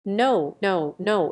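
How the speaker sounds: ordinary delivery, nothing marked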